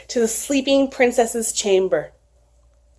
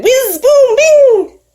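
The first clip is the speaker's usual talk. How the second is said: magic whoosh sound; 13 semitones higher, 6 dB louder, much slower than usual. A narrator's reading. Magic flight sound effect.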